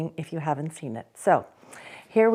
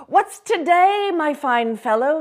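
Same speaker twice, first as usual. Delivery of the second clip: loud, higher hearty call; 9 semitones higher, 7 dB louder than usual. Acted. Scrooge calling to the boy below, hearty. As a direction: shout down cheerfully. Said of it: "calling down to a boy".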